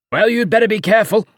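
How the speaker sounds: an uncle guffawing at his own joke; jeering uncle. Acted. Uncle teasing his nephew, loud and high.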